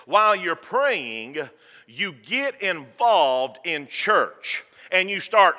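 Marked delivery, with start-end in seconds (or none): none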